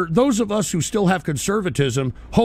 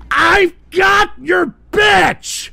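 slow, drawn-out furious yell, much higher and louder; furious shout; 11 semitones higher, 7 dB louder, much slower than usual. Real, not acted. Streamer shouting back at a soundboard insult.

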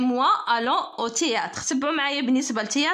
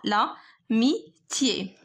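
very slow, syllables pulled apart: لا ميتيي; slow dictation; 3 semitones lower, much slower than usual. Shown as a demonstration. Teacher writing amitié for students, slow split-syllable dictation.

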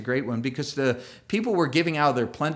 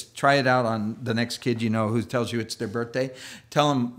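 ordinary delivery

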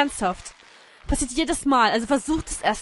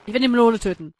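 ordinary delivery

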